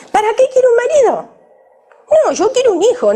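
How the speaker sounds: high, fast, scornful voice of a modern woman; mock defiant woman. Preacher mimicking women who want children without husbands, scornful.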